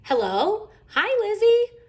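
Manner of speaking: high, loud happy hello; eager phone greeting. A narrator's reading. Sister answering a call from Lizzie, excited. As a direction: squeal a greeting to a friend.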